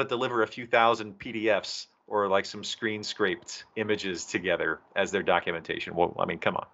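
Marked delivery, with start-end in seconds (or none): none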